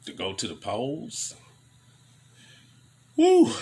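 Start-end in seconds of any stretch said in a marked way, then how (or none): 3.2-3.5 sharp, very high, loud "woo"; loud high whoop. Real, not acted. Mocking voters headed to the polls, a loud high whoop. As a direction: let out a piercing mock cheer. Exclaims after mentioning the polls.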